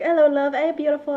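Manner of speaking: put-on thick British accent. Retelling British players greeting her in Discord, imitated British accent. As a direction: imitate British flirty hellos. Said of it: "you know, the thick accents".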